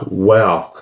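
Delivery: imitated low, stunned wow; hushed mock amazement; retold shock. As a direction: play her shock in one low word. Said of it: "she was full shocked".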